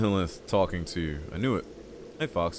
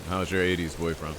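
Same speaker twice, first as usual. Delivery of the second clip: ordinary talk, nothing marked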